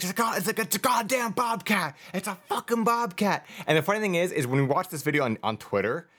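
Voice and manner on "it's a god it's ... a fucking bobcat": high, strained mock yell; the man losing it over the bobcat; imitating the panicked man. Retelling the man in the video, high frantic mock shouting. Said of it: "he's like".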